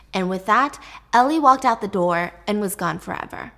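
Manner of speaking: usual speaking voice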